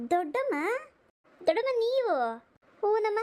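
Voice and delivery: surprised greeting. Acted. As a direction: sound delighted and startled to see her.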